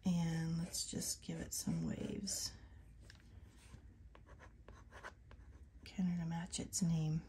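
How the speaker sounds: soft unvoiced whisper while drawing; hushed working whisper. Real, not acted. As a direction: whisper to yourself as you paint.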